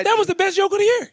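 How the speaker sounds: excited. Real, not acted. Raving about joke.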